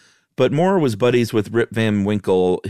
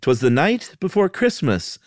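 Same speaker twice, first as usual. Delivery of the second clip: higher, singsong reading that loses the meter; reading the poem in a clumsy rhythm; 8 semitones higher than usual. Shown as a demonstration. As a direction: read 'Twas the night before Christmas with the beat slightly off. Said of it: "Can I read it like you wrote it?".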